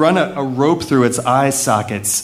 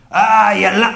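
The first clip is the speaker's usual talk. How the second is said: put-on failed South African accent; 6 semitones higher, 4 dB louder than usual. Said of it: "I can't do a South African accent".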